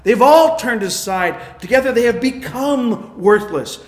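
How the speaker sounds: loud, slow preaching from the pulpit; slow, loud sermon delivery; full-force preaching. Acted. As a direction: proclaim it slowly like a verdict.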